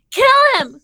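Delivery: high, loud mock outrage, kill repeated; mock furious shout. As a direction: yell it like a hammed-up mad editor. Mock outrage over grammar.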